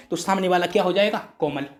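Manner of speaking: ordinary talk, nothing marked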